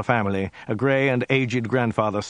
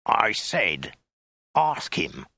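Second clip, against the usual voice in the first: deliberate soldier's hiss, broken by pauses; insistent, threatening order; menacing hiss; much slower than usual. A narrator's reading. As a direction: hiss it word by word. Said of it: "he hissed".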